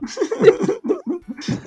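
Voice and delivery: high falling laugh. Real, not acted. Laughing at own joke.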